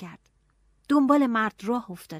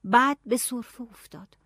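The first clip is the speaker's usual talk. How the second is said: ordinary delivery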